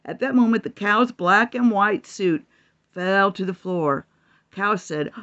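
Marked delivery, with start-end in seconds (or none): none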